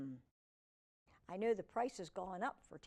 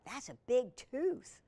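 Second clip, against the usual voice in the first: ordinary delivery, nothing marked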